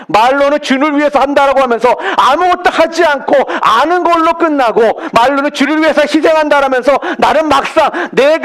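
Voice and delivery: preacher rising to full force; impassioned preaching. Acted. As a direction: drive the point home to the congregation. Preacher rebukes hollow faith.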